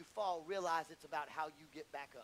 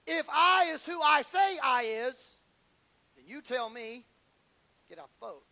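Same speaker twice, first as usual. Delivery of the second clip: Peter's challenge; 5 semitones higher, 13 dB louder than usual. Acted. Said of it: "Peter said".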